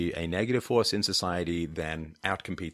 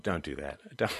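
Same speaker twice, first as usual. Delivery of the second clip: ordinary talk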